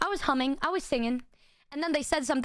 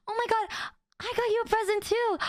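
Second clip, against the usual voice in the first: excited friend; 8 semitones higher than usual. Retold or imitated. Excited friend voiced in story.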